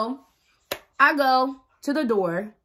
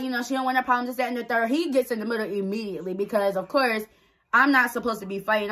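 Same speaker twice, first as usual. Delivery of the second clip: usual speaking voice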